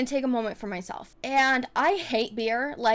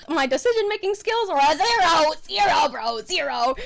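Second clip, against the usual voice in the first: self-mocking; 4 semitones higher, 6 dB louder than usual. Real, not acted. Mocking her own bad choices.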